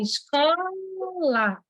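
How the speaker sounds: "Escola" very slowly as Es-co-la, with a long held "co"; stretched into syllables. Shown as a demonstration. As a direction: drag out each syllable of "Escola". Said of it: "muito fácil de dividir escola".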